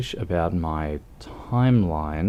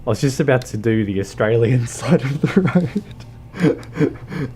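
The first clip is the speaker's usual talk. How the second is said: real laughter breaking into the words, higher and louder; laughing; 5 semitones higher, 4 dB louder than usual. Real, not acted. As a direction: laugh through your own mistake. Laughs at own driving mistake.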